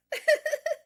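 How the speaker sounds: high burst of giggling; real laugh. Real, not acted. Streamer laughing after saying sorry, high giggle. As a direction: laugh out loud, high and quick.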